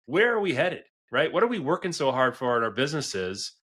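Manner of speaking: ordinary delivery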